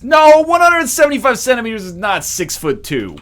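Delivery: loud, high-pitched correction; exasperated shouted "no" and rebuttal; exasperated outburst. Real, not acted. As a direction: burst out correcting them, voice shooting up. Exasperated correction of height conversion.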